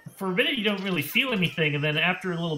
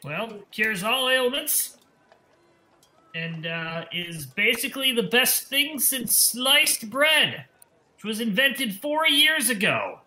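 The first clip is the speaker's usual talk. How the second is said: snake-oil salesman; 7 semitones higher, slower than usual. Acted. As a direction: sell it like a carnival quack hawking a cure. Salesman keeps pitching tonic.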